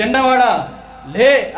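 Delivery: high, loud command to rise; commanding call. Acted. Christ calls the dead young man to rise, commanding shout. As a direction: call out with authority.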